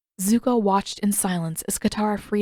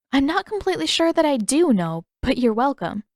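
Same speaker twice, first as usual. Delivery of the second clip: a hint of amused teasing, higher; lightly voiced Katara; 6 semitones higher, 3 dB louder than usual. Katara answers Zuko's awkward thanks, lightly amused. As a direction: gently poke fun at his thanks.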